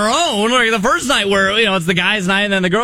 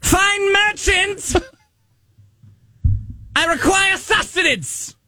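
town crier voice; 9 semitones higher, much slower than usual. Acted. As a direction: bellow it out like a medieval crier. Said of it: "in the town crier voice".

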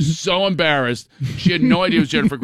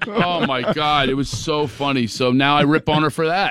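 ordinary talk, nothing marked

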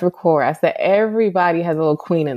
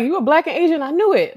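higher, teasing voice of someone who guessed wrong; lightly voiced know-it-all; mock clueless person; 11 semitones higher, faster than usual. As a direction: poke fun at the mix-up. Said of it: "People be like".

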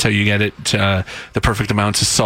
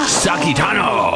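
mock Japanese name; 4 semitones higher, slower than usual. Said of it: "I had no idea we had a Japanese".

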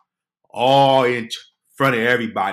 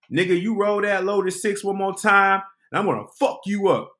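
higher, louder threat at the dice game; threatening dice player; 9 semitones higher, 3 dB louder than usual. Retold or imitated. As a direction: threaten him over loaded dice. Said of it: "all I heard is somebody say, quote".

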